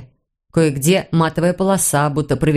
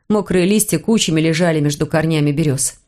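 ordinary delivery, nothing marked

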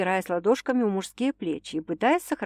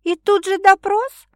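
high, louder, slow mock outrage; mock indignation; 12 semitones higher, 8 dB louder than usual. A narrator's reading. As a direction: play offended at the questioning. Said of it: "возмутилась она".

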